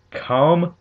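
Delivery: high, loud incredulous yell; outraged shout shooting upward. A narrator's reading. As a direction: yell it as a rising question. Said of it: "Hammond yelled".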